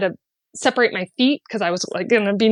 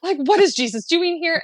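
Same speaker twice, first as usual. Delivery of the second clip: high, squealing excitement, much higher pitch; fangirling; 9 semitones higher than usual. Retold or imitated. Telling friends about seeing Jesus, high excited fangirl squeal. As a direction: starstruck fan gushing in disbelief. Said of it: "I was fangirling".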